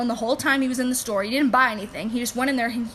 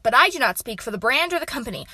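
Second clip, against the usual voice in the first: usual speaking voice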